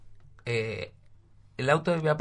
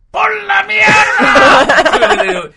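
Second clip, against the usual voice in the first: much higher, louder, drawn-out outraged curse; furious shout; 12 dB louder, much slower than usual. Retold or imitated. Imitating his father discovering the dented car, furious shout. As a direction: roar like a father finding his car wrecked. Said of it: "mira y dice".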